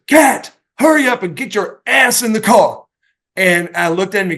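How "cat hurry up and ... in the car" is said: higher-pitched, mock-urgent order; retold impatient dad. Storyteller voicing his dad ordering Cat into the car, mock impatient. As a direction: hurry him along, lightly acting the dad. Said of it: "my dad goes".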